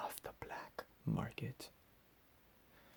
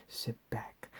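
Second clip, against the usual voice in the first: normal talking voice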